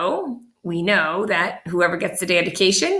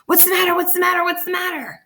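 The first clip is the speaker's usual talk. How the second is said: startled yell; 11 semitones higher, faster than usual. A narrator's reading. Woken father yells in alarm at his son. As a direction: jolt awake and shout it. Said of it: "His father jumped out of bed and yelled".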